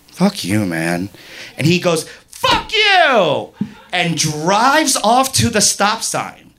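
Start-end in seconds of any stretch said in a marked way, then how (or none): 0.1-1.1 low, unruffled dismissal; calm. Retold or imitated. Woman to the rude driver, calm and low. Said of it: "calmly go". 2.3-3.5 high, stretched-out angry yell; yelled retort. Retold or imitated. Driver hollering back before speeding off, high and furious.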